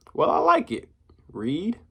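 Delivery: bright high tease; high playful. A narrator's reading. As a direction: sing the name teasingly. Diamond teasing the name.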